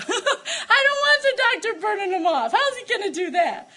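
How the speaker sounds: put-on high, half-laughing little girl voice; childlike laughing plea. Teller as a scared little girl, pleading with a laugh.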